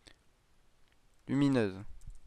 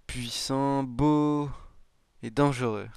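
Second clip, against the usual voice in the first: usual speaking voice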